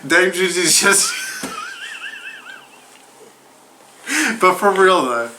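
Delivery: stumbling through the name while laughing. Real, not acted. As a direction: keep cracking up on the name. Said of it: "I can't say her name".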